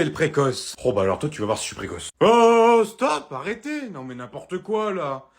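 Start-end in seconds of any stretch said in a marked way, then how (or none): 2.2-3.9 high, drawn-out cry for order; calling a halt. Acted. Relative breaking up a quarrel at home, crying out. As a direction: cut across the quarrel loudly.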